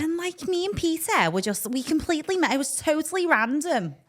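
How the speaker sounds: high, lilting Scouse voice as Abby Clancy; Scouse accent. Acted. Husband asks for Abby, she answers in high Scouse. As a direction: put on a Scouse celebrity accent. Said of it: "give me a bit of Abby".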